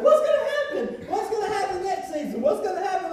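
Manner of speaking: giddy laughing what-happens-next; excited cliffhanger. Acted. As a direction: tease the suspense like a TV promo. Cliffhanger joke.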